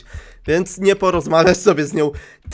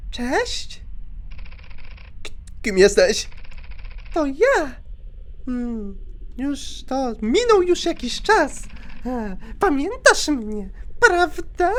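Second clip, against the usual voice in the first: high, soft, slow sinister woman on the phone; creepy caller voice; 8 semitones higher, 4 dB quieter, slower than usual. Acted. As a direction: purr it like a creepy stalker. Gracz gra stalkerkę przez telefon. (wysoki, cichy, złowieszczy głos dzwoniącej)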